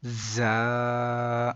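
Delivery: زا with a sharp z and long steady aa; hard z, elongated. Shown as a demonstration. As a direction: buzz into زا and hold the vowel. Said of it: "سختی سے پڑھیں گے". (زا سختی سے، لمبا کر کے)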